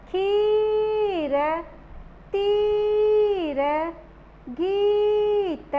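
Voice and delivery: each word very slow with a long ee; slow drawn-out words. Shown as a demonstration. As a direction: read the list slowly, holding ee. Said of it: "अब हम इसका उच्चारण करते हैं". (धीरे-धीरे ई को लंबा खींचकर)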